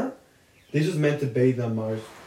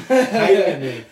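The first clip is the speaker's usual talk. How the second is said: laughing; 5 semitones higher, 6 dB louder, slower than usual. Real, not acted.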